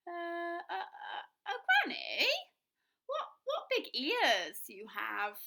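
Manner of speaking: high, halting, slow stammer; unsure and a little scared; nervous stammer. Acted. Girl nervous questioning.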